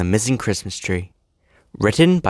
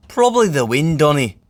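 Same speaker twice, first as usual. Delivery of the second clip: higher, dismissive twin engine; Douglas voice; 5 semitones higher, 4 dB louder than usual. Acted. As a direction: brush it off. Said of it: "said Douglas".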